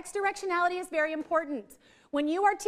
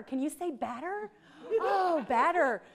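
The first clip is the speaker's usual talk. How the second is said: teaching mom; slower than usual. Retold or imitated. Mom coaching child on new word.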